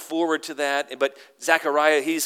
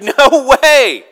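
very loud, high mom outburst; hammed-up interrupting mother; emphatic refusal; 11 semitones higher, 14 dB louder than usual. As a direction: cut in with a big, loud protest. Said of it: "it's an emphatic no".

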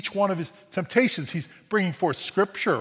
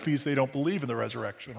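ordinary talk, nothing marked

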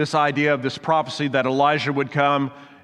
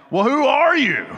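high, loud burst of exasperation; exasperated; 11 semitones higher, 5 dB louder than usual. Acted. Fed-up delegation questioning John, exasperated. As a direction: throw up your hands and demand an answer. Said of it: "you can almost hear the exasperation".

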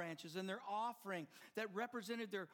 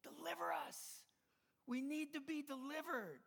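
higher, pleading crowd cry; the crowd's plea climbing higher; crowd yelling; 7 semitones higher than usual. Retold or imitated.

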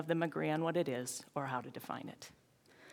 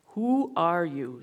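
slow, louder, stern demand; stern caterpillar; 6 dB louder, slower than usual. A narrator's reading. The caterpillar sternly questions Alice, slow and stern. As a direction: drag each word out like a stern caterpillar. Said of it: "said the caterpillar sternly".

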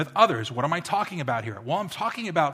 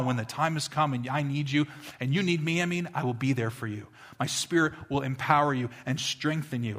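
usual speaking voice

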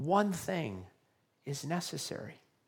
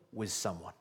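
ordinary delivery

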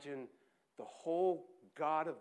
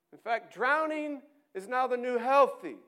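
loud, high, confident pronouncement; know-it-all academic voice; lecturing theory; 9 semitones higher, 10 dB louder than usual. Acted. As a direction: announce it like a fad. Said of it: "the theory says".

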